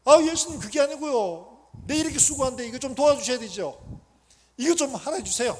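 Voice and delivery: loud, high-pitched complaint; indignant pleading. Acted. Worshipper complaining to Jesus when work goes badly, indignant pleading. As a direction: protest loudly to get some help.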